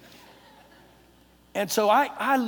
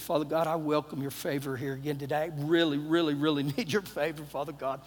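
low, soft plea; quiet earnest prayer; 7 semitones lower, 7 dB quieter than usual. Retold or imitated. Traveler asking God for a seat, quiet earnest prayer. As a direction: pray low and hushed.

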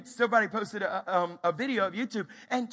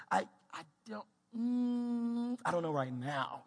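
reluctant; 3 semitones higher, slower than usual. Acted. Acts struggling to admit ignorance.

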